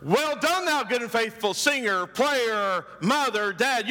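high rising Well done, repeated in list; lightly hammed-up divine greeting; mock heavenly praise. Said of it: "You're not gonna hear".

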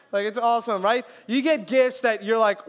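ordinary talk

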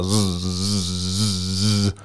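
soft buzzing reel-line noise; mimicking the fishing reel spinning. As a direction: imitate the reel screaming out line. Imitating reel line running out.

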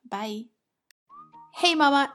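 ordinary talk

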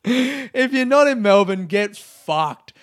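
loud, high jokey yell; mock shout. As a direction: bark it like a mock threat. Repeats the jokey rant louder.

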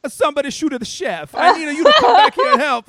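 high, loud hammed-up cry for help; mock alarmed shout. Waiter yells to a diner mid-meal, mock alarm. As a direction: yell it high with a laugh breaking in. Waiter calls out in imagined emergency.

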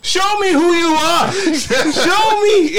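very high, loud, slow pleading; falsetto character voice. Acted. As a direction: squeal it out slowly. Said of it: "can do voices so good".

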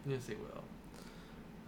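slow, hushed whisper. Real, not acted. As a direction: breathe it slowly, ominous.

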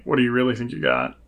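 ordinary talk

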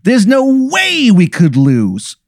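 high, slow boastful wiseguy; drawn-out mob boss bragging; Tony Italiano mobster. Acted. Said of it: "he goes".

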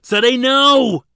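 slow, very high, very loud anguished scream. A narrator's reading. Ladin screaming at Zadeh to stop, anguished scream. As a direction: scream her name as the sphere opens. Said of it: "Ladin screamed".

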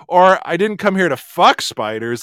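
"fuck" hit hardest; stress on fuck. Shown as a demonstration. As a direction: punch "fuck" to imply other business. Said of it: "by the word you emphasize".

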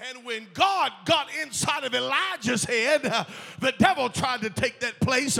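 loud, fervent sermon shouted to the church; full-force preaching. Acted.

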